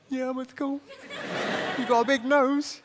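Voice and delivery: high-pitched schoolboy impression; mock schoolboy. Schoolboy answers then heckles the comic, mock high voice. Replays the young student heckler.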